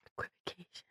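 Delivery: "Equivocation" whispered, all breath, no voice; mock ASMR whisper. Host mocking the pronunciation tool in an ASMR whisper. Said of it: "This has been Pronunciation with A S M R Bing".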